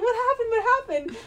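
high-pitched urgent questioning; imitated alarmed mom. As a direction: ask like a panicked mother. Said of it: "My mom's like".

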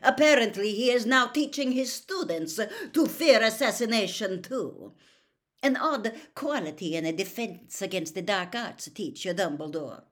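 scornful complaint, settling down; loud scorn. A narrator's reading. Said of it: "said Karkaroff loudly".

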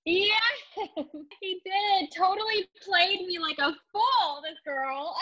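high-pitched, amused admission; real laughing. Real, not acted. Wife admitting the wingwoman fooled her, laughing. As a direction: laugh through the confession of being fooled. Laughing at being tricked by friend.

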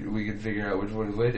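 usual speaking voice

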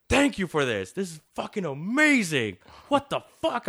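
high, loud, gushing thanks; a chef overjoyed by a gift; imitating thrilled chef. Chef thanks them excitedly.

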